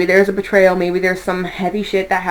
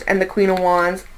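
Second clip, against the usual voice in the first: ordinary delivery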